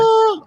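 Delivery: high angelic choir note; heavenly "ah". Retold or imitated. Finding the roll behind louvered doors, mock heavenly chorus. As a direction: sing out a high ray-of-light "ah". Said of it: "like a heavenly golden".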